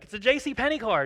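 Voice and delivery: a retold indignant insistence, pitch jumping up. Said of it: "I'm kinda getting a little indignant now".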